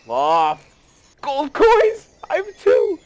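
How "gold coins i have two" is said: very high, loud squeal; loud, high-pitched delight; thrilled. Real, not acted. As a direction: squeal over a lucky find. Finds gold in the chest.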